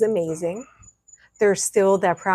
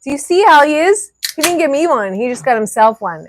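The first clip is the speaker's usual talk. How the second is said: loud complaint; 6 semitones higher, 8 dB louder than usual. Real, not acted. Complaining he kept the beer.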